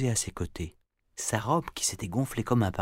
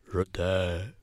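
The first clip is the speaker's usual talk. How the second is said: dragon triste; 2 semitones lower than usual. Acted.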